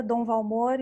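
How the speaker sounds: usual speaking voice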